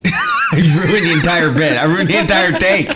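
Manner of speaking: laughing loudly through the words. Real, not acted. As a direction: crack up while admitting the blunder. Laughing after ruining bit.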